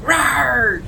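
loud, high-pitched mock roar. Woman imitating the man who chased her as a child, mock roar. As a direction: imitate him yelling a monster growl. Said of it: "and s-, yell".

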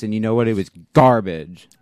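booming it out; loud declaration. Real, not acted. Loud provocative verdict.

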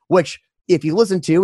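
ordinary talk, nothing marked

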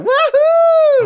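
high, loud joyful woohoo; excited whoop. Pretend. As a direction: whoop like a gleeful old man out fishing. Imagining Q fishing in boat.

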